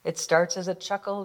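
ordinary delivery, nothing marked

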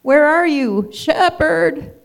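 high, loud desperate call; lost sheep crying out for help; frightened calling out. Acted. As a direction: shout for the shepherd in the dark.

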